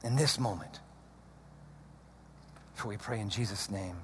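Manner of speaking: very soft, low praying voice; barely voiced closing prayer; hushed prayer. Real, not acted. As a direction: pray intimately with long silences between phrases.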